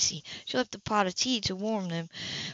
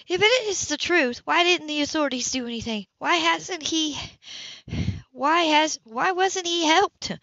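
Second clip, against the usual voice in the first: terrified; 8 semitones higher, 4 dB louder than usual. A narrator's reading. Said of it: "She was terrified".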